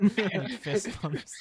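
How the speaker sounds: laughing through the words. Real, not acted. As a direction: giggle while saying it. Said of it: "laughing while narrating".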